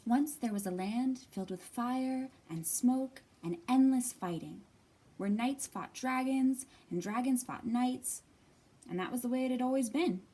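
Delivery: story reading. Acted. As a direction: tell it like a bedtime tale. Reads picture book aloud.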